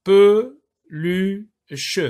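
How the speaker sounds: lightly voiced child repeating the word; a hint of a child sounding it out; imitating a pupil. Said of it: "Alors lui, il répète comme ça".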